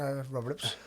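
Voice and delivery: light put-on Traveller accent; imitated Traveller accent. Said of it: "speaking like a traveller".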